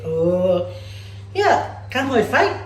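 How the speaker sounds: ordinary talk, nothing marked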